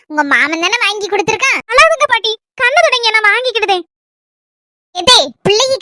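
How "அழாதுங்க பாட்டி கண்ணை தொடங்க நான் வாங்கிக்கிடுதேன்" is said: ordinary talk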